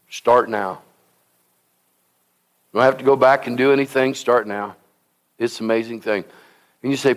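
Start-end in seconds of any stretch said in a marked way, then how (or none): none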